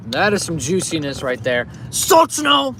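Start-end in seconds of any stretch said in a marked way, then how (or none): 1.9-2.7 high shout of Sochno. Pretend. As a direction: cheer Sochno out loud. Shouting the Russian word for juicy.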